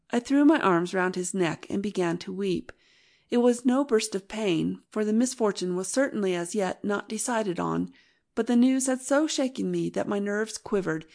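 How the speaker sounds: usual speaking voice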